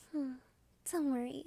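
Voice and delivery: usual speaking voice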